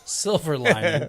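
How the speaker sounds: "Silver lining" drawn out slow, high and loud; emphatic correction. Real, not acted. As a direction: punch out "Silver lining" loudly and slowly. Repeats the correction over the mix-up.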